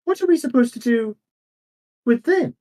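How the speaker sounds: high, fast exasperated demand; exasperated protest. Acted. Council member challenging the plan, exasperated and high. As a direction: throw the question up in alarm.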